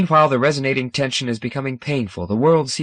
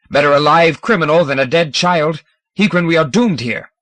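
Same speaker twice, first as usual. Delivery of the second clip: loud, higher shout; 5 semitones higher, 6 dB louder than usual. A narrator's reading. Scomber shouting at Hegrin in argument. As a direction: shout across the quarrel. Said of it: "a crimson shout from Scomber".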